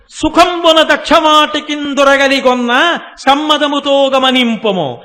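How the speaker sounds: slow, sustained, ringing verse; loud verse recitation. Retold or imitated. Goddess sending Vyasa to Daksharamam, loud slow verse recitation. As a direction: declaim the verse loudly with long vowels.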